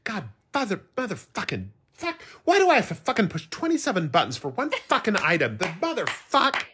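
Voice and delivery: high, frustrated swearing fit; exasperated cursing. Retold or imitated. Drive-thru worker struggling with the register, cursing in exasperation. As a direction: imitate someone losing patience with a machine.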